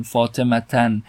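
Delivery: فاطمتن ending in -tan, slow; tanvin -an ending. Shown as a demonstration. Teacher shows students a form not used, فاطمتن ending in -tan. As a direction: sound the final -an in فاطمتن. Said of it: "نه اینطور نمیگیم".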